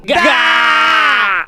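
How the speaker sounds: drawn-out "gaaa" shout; long catchphrase yell. Pretend. Donation reader ending with the show's catchphrase, long yell. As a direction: hold the yell on one syllable.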